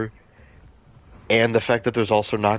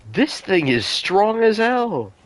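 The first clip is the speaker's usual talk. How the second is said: high, slow amazed outburst; drawn out in awe; excited; 11 semitones higher, slower than usual. Real, not acted. Amazed at dinosaur strength.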